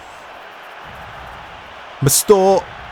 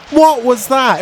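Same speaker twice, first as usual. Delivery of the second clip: shout; 9 semitones higher, 4 dB louder than usual. Real, not acted. Gamer reacting to match event.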